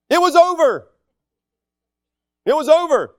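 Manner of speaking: "It was over" pitched high and loud; emphatic finality. Real, not acted. Preacher on dad's forgiveness, loud emphatic "over". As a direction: punch "over", then drop with finality.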